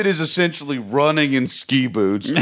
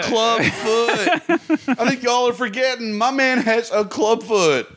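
put-on Southern accent; 9 semitones higher than usual. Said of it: "God, I wish I had a Southern accent".

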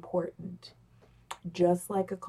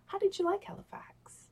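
whisper; 11 semitones higher, 6 dB quieter than usual. Shown as a demonstration. Demo question whispered.

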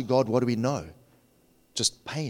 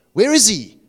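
doubting cry; 6 semitones higher, 8 dB louder than usual. Acted. Voicing doubter's question.

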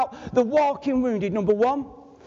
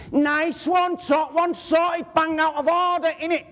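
Mancunian slang; 5 semitones higher than usual. Shown as a demonstration. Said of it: "Don't fit, does it".

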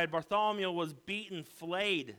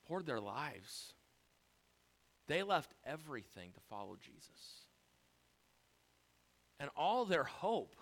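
very soft and low, with long pauses; hushed, somber; 6 semitones lower, 7 dB quieter than usual. Real, not acted. Preacher telling of the disciples' grief at the cross, hushed. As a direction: let each phrase sink almost to silence.

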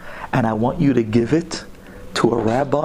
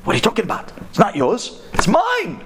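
high, frantic cabbie with a disbelieving laugh; outraged and incredulous, pitch way up; worked up; 11 semitones higher than usual. Acted. Taxi driver demanding his Olam Haba back, frantic high voice. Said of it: "The Nahag starts getting all worked up".

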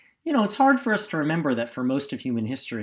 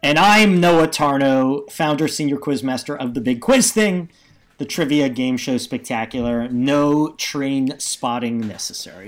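loud, drawn-out announcer introduction; show host intro; 10 dB louder, slower than usual. Acted. Co-host introducing himself as quizmaster, announcer style.

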